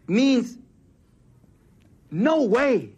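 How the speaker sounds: ordinary talk, nothing marked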